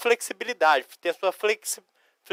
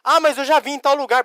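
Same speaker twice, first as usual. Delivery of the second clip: higher, quick pupil's protest; objecting student; 7 semitones higher, 4 dB louder, faster than usual. Acted. Pupil protests to teacher, high quick objection. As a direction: butt in fast like a pupil objecting. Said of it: "Pois é, não importa onde você viu".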